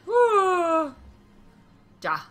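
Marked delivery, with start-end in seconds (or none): 0.1-0.9 high, loud whoop; excited cheer. Real, not acted. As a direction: whoop in triumph. Cheers in the game.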